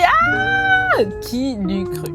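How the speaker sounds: ordinary talk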